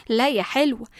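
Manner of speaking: ordinary delivery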